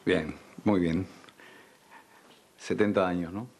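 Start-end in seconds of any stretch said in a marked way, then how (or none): none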